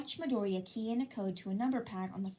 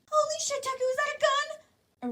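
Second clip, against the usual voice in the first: very high, loud panicked shriek; high panicked girl yelling in alarm; shocked shriek; 7 dB louder than usual. A narrator's reading. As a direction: scream it as you jump back. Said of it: "Araka scrambled back".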